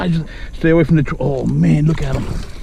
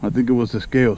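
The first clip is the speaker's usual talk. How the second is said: usual speaking voice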